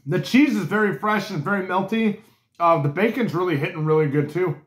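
chewing between words; talking around a bite; mouth full of food. Real, not acted. Seen 0: holds burger, cheeks and lips working on food while speaking.

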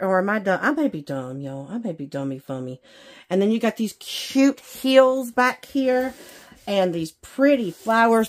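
usual speaking voice

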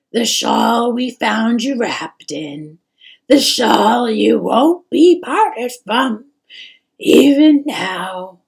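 loud, slow, emotional mother; aching, weepy reminiscence; tearful. A narrator's reading. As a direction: draw the words out, close to crying. Tearful mother speaks of shawl.

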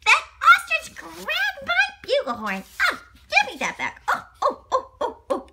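very high, loud laughing cry; high panicked George. Acted. George begging the ostrich for his bugle. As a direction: squeal as the bugle is snatched.

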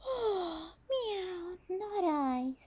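high, airy cat who can't be bothered; snooty bored cat. Acted. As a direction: sigh it out like a lazy cat. Cat refuses, gasping.

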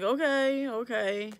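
lightly hammed-up dad's okay; imitating dad. Acting out dad giving up.

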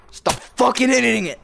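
loud, high burst of irritation; angry shout. Real, not acted. Gamer to someone hitting the camera, angry high shout. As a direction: yell at them to quit it.

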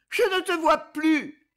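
high, loud and slow, full of distress; distressed cry. A narrator's reading. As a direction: cry out, afraid he's gone. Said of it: "d'un ton de détresse".

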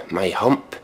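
normal talking voice

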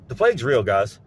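ordinary delivery

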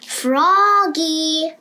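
long loud high call; mother calling her son. A narrator's reading. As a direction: call him from across the house. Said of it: "called his mother".

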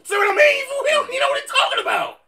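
shouting. Real, not acted. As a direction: yell it in disbelief. Heated argument, shouting.

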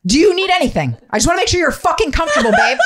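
high, loud mock-sweet sarcasm; high sarcastic fake concern. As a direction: needle him in a high fake-caring voice. Sarcastic care for husband.

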